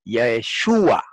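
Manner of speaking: Yeshua slowed right down, accent on the U; stress on U. Shown as a demonstration. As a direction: stretch Yeshua out, leaning on SHU. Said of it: "El acento cambia también".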